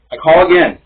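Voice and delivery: plain reading voice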